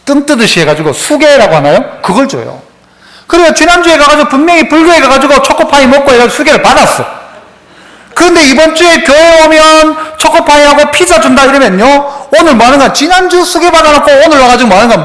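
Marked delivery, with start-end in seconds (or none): 3.3-15.0 raised, fervent sermon voice; preaching. Acted. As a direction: preach it out to the congregation. Preaching at full force.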